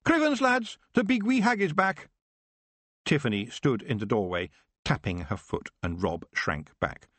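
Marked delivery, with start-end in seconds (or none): 0.0-2.1 high alarmed Scottish yelp; sudden raised Scots cry; startled Scots. Acted. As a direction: caught out, warning the lads. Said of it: "interrupted by a sudden".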